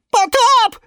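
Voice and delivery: very high, loud cry of alarm; alarmed shout. A narrator's reading. Second ficus joining the panic, shouting. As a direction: shriek it as if water is pouring in. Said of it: "подхватил фикус Вениамин".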